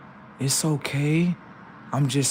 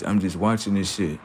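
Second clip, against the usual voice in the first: ordinary delivery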